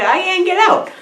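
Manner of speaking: high-pitched mock wail; imitated high-voiced complaint; mock protest. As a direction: whine it high as the trapped creature. Locked-up creature protests in retelling.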